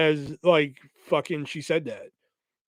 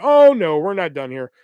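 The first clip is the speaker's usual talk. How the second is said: defiant outcry; 7 dB louder than usual. Retold or imitated. Refusing to let the blocked friend end it, loud. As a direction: belt it out in defiance.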